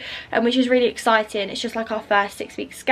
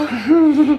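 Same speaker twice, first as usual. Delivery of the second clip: short real laugh, louder; burst of laughter; 4 semitones higher, 6 dB louder than usual. Real, not acted. Vlogger laughs to viewers about her constant breathlessness, short real laugh. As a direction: laugh at herself out loud.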